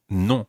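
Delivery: "non" with a nasal French vowel, drawn out. Shown as a demonstration. Teacher recaps no for learners, "non" nasal. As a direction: say "non" slowly through the nose. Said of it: "get that nasal sound".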